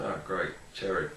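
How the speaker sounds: low, soft mock cheer; quietly underwhelmed, voice dropped low; unenthused sarcasm. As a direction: retell it as a deflated, sarcastic aside. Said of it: "I was like".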